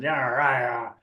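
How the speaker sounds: rolling pirate-style Cornish growl of agreement; Cornish accent. Acted. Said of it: "doing these Cornish accents".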